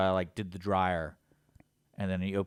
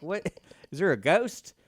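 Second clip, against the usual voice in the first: mock startled; 7 semitones higher, 5 dB louder than usual. Startled by a voice outside.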